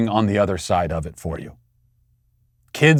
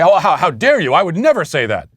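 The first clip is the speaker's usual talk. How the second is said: mock indignation; 6 semitones higher than usual. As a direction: squeal it like a huffy opponent. Mimicking opponents' indignant denial.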